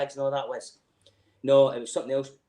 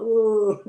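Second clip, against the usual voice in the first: high put-on groaning oh; mock groan; 8 semitones higher, 8 dB louder than usual. Mimicking Scouse outside work, high mock groan. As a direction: moan it like a sulking mate. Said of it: "Scouse like".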